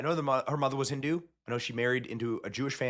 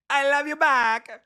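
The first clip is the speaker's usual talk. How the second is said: Kamala Harris; 12 semitones higher, 6 dB louder, slower than usual. Retold or imitated.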